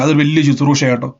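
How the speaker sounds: usual speaking voice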